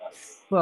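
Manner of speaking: "स" as s, tongue blade forward; softly isolated s in "स"; S sound demo. Shown as a demonstration. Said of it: "जैसे हम स बोलते हैं ना". ("स" जीभ आगे करके)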